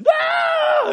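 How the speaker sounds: hammed-up panicked shriek; panicked scream. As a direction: scream as if on fire. Mock burning man screams.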